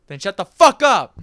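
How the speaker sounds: mock angry shout. Friend doubting the book was read, mock angry shout. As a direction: burst out loudly to silence him.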